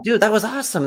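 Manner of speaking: over-the-top praise to a stranger; enthusiastic praise. Retold or imitated. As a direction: gush at them like a fan. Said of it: "So you tell 'em".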